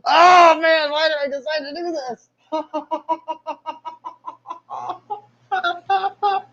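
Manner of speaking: high, strained cries of pain mixed with laughter; real pain, laughing and crying out. Real, not acted. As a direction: yelp through the shock. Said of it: "that hurts so bad".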